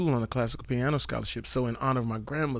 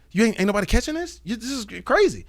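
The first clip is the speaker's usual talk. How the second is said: retold excitement; 7 semitones higher, 4 dB louder than usual. Retold excited pitch to Puff.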